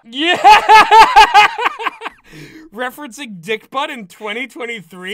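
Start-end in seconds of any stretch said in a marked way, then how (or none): 0.4-2.7 high, very loud cackle; loud laughing fit. Real, not acted. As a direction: howl with laughter.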